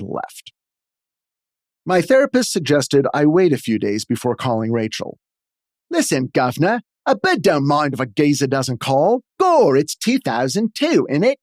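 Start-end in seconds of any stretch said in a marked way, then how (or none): 5.9-11.4 hammed-up Cockney British accent. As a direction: lay on a thick London British accent. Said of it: "trotting out my flawless British accent".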